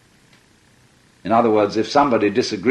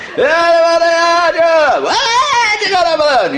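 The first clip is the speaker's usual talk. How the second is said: much higher, loud preacher's call; revivalist preacher; 7 dB louder than usual. Retold or imitated. Imitating the revivalist rousing the crowd, a high loud call. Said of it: "he was saying".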